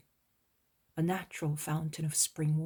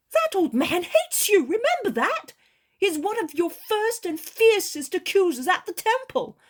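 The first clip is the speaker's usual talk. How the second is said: high, loud, resentful fisherman; Peter, indignant warning; 11 dB louder than usual. A narrator's reading. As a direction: Peter reminds the master of Eli's hatred. Peter warns against Eli.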